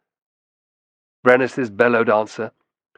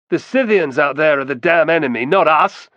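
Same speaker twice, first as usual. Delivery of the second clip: loud, higher indignant protest; angry protest; 6 semitones higher, 7 dB louder than usual. A narrator's reading. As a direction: push back hard at the accusers. Protesting accusation.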